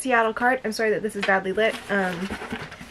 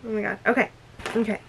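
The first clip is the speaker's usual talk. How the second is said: normal talking voice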